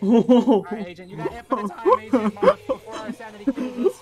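frantic shout; panicking gamer. Real, not acted. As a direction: yell it out in panic. Gamer yelling during chase.

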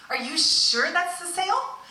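high, slow, astonished question; shocked shopper checking the price; disbelief. Retold or imitated. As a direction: pitch up in stunned disbelief.

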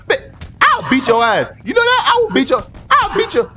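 high, loud blustering yell; mock-angry threat. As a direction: threaten a friend in hammed-up rage. Threatens friend in a game fight.

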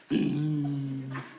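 low, steady vocal car hum; engine drone. Acted. Comic telling of Lulu driving from the party, engine drone. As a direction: imitate a car droning along. Car drive mimicked in routine.

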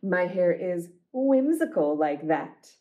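ordinary delivery, nothing marked

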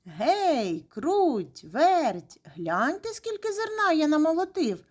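drawn-out names, rising high at the end; hollering. A narrator's reading. Rooster calls mice to see grain, hollering. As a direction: call out proudly across the yard. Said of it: "гукнув".

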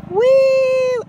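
long, loud, high-pitched woo; drawn-out gleeful squeal; high playful whoop. Acted. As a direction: whoop across someone's shot.

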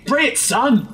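Nick Fury voice. Retold or imitated. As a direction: bark it like a tough action hero. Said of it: "like, this voice going".

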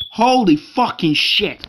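loud, startled cursing; sudden loud gamer outburst; alarmed outburst. Real, not acted. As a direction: swear loudly as the game turns on you. Attacked while playing.

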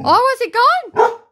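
high, loud, thrilled disbelief; delighted gasping at the vanished film; excited. Real, not acted. Owner thrilled as the dog loses the film, excited high cries.

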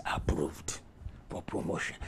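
low, soft, half-whispered pronouncement; hushed verdict. Acted. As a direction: drop right down for God's verdict. Preacher drops voice as God.

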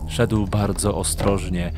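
plain reading voice

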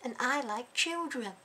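[name] voice. Pretend. Vlogger mocking the troll, hammed-up [name] voice. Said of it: "I can try and do a [name] voice".

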